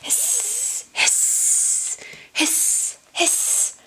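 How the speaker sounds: soft hissing snake; breathy snake hisses. Acted. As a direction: hiss it out like a sly snake. Snake sound in picture book.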